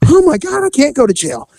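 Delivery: high-pitched imitation of a panicking suspect; mock panic. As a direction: act out a sudden, pleading panic up high. Suspect panics in retold arrest.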